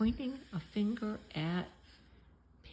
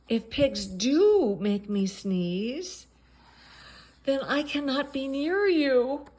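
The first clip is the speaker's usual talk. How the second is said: mock-tragic lament; 6 semitones higher, 6 dB louder than usual. Gerald's dramatic lament.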